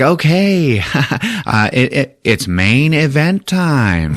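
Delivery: high showman announcer. Retold or imitated. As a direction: hype it like an arena host. Grandmaster announcing the main event.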